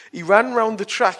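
ordinary talk

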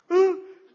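sharp, high, loud gasp of shock. Acted. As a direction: gasp as if seeing the leprous hand. Moses sees leprous hand.

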